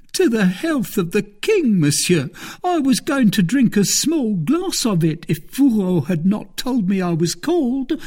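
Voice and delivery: high-pitched, anxious servant voice; frightened servant. A narrator's reading. Planchet excusing himself to his master, frightened.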